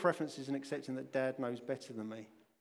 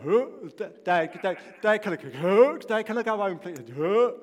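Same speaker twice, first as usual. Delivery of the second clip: panicky begging; 5 semitones higher, 10 dB louder than usual. Retold or imitated. Panicking daughter begging to go home.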